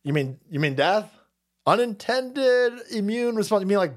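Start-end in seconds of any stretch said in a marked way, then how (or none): 1.7-3.6 high sneering mimic of the phrase; mocking parrot. Parroting the headline to viewers, high mocking sneer. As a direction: echo the jargon like a smug official.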